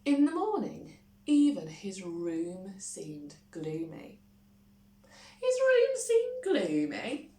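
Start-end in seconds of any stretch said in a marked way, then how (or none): 5.4-7.3 high, loud puzzled rise; surprised question. A narrator's reading. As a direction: catch the odd word in mock surprise. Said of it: "Just a minute".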